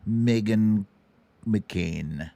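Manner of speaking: usual speaking voice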